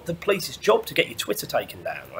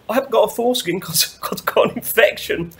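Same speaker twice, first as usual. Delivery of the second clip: whimpering; 8 semitones higher than usual. Retold or imitated. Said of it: "he's whimpering".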